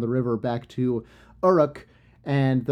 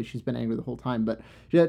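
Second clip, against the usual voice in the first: usual speaking voice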